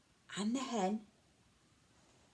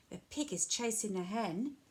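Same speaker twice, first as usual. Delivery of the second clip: plain reading voice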